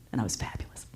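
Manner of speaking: hushed, sly brag; whispered sass. Real, not acted. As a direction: whisper it smugly. Self-praise, hushed punchline.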